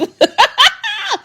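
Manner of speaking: shrill burst of laughter at her own joke; giggling at her own mock threat; high squealing laugh. Real, not acted. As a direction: laugh high and loud, delighted.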